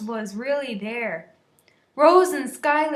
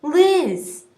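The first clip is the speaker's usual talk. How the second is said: mild call, slightly louder; a light shout for Liz; calling a name; 3 semitones higher, 5 dB louder than usual. A narrator's reading. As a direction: call out her name across the yard. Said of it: "my name being called".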